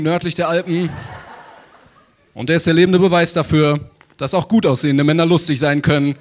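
booming stage introduction building to a shout; grand entrance announcement. Acted. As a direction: hype up the guest's entrance.

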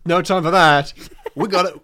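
party-mad soldier. Retold or imitated. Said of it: "he goes like".

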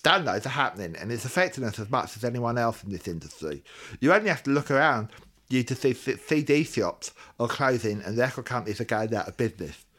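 normal talking voice

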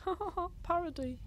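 high, soft mock laughing; imitating amused friends. Imitating everyone else at a sleepover, mock amusement. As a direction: fake a little high-pitched chuckle. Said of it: "everyone else was like".

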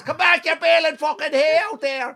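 high holler cracking into laughter; coach's shout. Retold or imitated. Coach calling back lad gone to the next pitch, exasperated shout. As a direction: imitate an exasperated Clare coach yelling far away. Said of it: "He's shouting to his young lad".

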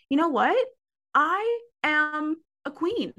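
crown yourself, savoring every word; mock-regal proclamation to herself; proud declaration.

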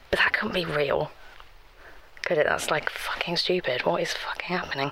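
panicked whisper; hushed panic, scared of being heard; freaking out. Acted. Alone in the house, fearing an intruder, panicked whisper. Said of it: "I'm really freaking out".

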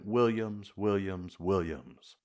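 ordinary delivery, nothing marked

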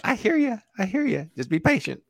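high-pitched mock soothing; mock placating. Behind on Discord requests, answering impatient people, mock placating.

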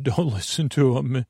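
usual speaking voice

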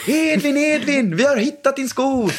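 high, theatrical game-master voice; playful game voice. Retold or imitated. Dad calling his son into a pretend mission, high theatrical voice. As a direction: sell it like a grand adventure to the kids.

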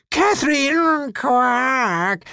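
very high, slow cry of a name ending in a quack; high slow call collapsing into a croak; woman croaking. A narrator's reading. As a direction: she calls her daughter and turns into a toad. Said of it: "machte die Frau noch".

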